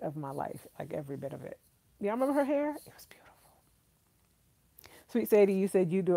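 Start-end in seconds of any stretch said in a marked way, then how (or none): 2.7-3.3 breathy admiring whisper. Real, not acted. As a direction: whisper it as if awestruck. Swooning over Angela Bassett's hair.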